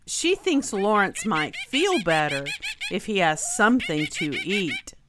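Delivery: plain reading voice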